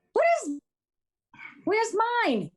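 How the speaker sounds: very high, put-on whiny complaint; mock whine. Guest jokingly asks the host for wine, in a high mock whine. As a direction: squeal it like a left-out kid, jokingly.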